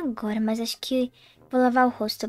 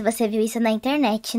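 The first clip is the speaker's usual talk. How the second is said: ordinary talk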